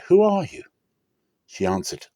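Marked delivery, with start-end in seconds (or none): 0.1-0.6 sudden high, loud cry of alarm; jolted awake and blurting out the question; startled. Acted. Boaz waking to find a woman at his feet, startled high cry. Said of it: "At midnight the man was startled".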